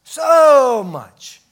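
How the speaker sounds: "So much" rung out high and loud; big swelling stress on "So"; emphatic. Shown as a demonstration. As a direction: hit "So" hard, almost a cry. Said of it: "you put that emphasis there".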